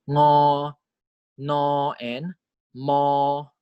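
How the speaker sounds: "ngo", "no", "mo" opened through the nose, vowel held level; nasal consonants. Shown as a demonstration. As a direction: hum into each syllable and sustain it. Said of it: "there's airflow in our nose".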